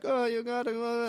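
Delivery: high-pitched caricature of Jamaican men protesting; mock Jamaican accent. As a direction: imitate excited Jamaican old guys. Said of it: "there's, like, a bunch of Jamaican dudes".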